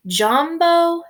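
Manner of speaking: Jambo said high and drawn out; slow teaching read. Shown as a demonstration. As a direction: lift Jambo up high for the class. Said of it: "written them out for you".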